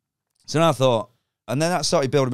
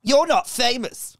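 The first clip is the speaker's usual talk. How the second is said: put-on high, sneering kid voice; mock a bratty girl, high up; 6 dB louder, slower than usual. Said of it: "This girl went".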